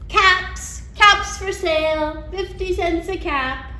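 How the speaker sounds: loud, sing-song peddler hawking caps; peddler calling. Acted. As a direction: call out your wares to the town.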